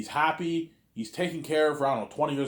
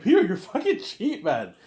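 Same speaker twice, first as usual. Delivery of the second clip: laughing high and slow; mock outrage through a laugh; 6 semitones higher, 4 dB louder, slower than usual. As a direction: laugh it out in disbelief. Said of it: "I'm like".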